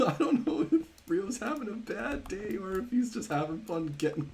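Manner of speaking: high-pitched, talking through real laughter; can barely get the words out for laughing; giggling in disbelief at a silly death; laughing hard. Real, not acted. Friend died falling two feet.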